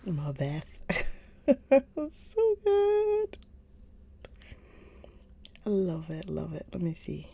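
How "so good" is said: much higher, louder squeal of So good; squealing gush. Real, not acted. Recommending books to chat, squealing So good. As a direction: squeal with delight. Gushing about book series.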